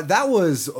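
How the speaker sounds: ordinary delivery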